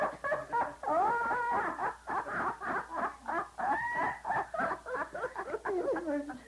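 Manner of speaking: high, rolling laughter; laughing hard at the punchline; long hearty laugh. Real, not acted. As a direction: laugh long at your own joke.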